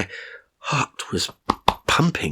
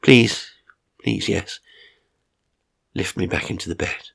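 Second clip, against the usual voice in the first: softened tone. A narrator's reading. Old man softening toward his carer. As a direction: ease off and ask gently. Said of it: "softening my tone".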